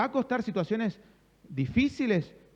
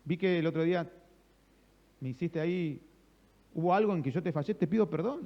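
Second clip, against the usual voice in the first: soft, conciliatory retold apology; gentle apology; 4 dB quieter than usual. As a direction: ask forgiveness without any fight in it. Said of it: "la blanda respuesta aplaca la ira". (disculpa suave y conciliadora)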